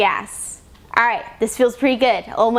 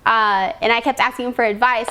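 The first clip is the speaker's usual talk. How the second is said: ordinary talk, nothing marked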